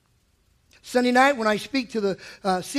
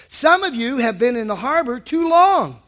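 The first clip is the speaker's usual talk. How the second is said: ordinary talk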